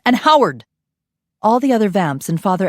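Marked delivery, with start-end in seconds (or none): 0.1-0.6 loud, high call after them; yelled. Acted. Father yelling after the guards. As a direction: yell down the hall. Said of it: "Roman yelled after them".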